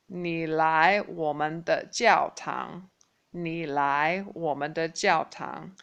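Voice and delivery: 你来我们的教堂 said slowly, syllable by syllable; slow model sentence for repeating; slow drill. Shown as a demonstration. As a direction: spell it out slowly for learners.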